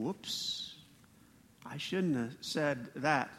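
hushed, slow "whoops" of someone caught out; sheepish regret. Acted. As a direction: drop it low and soft, as if owning up. Voicing people caught out at judgement.

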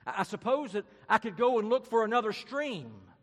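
put-on very high, loud, stammering girl; hammed-up nervous high voice; flustered girl. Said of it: "said Jill".

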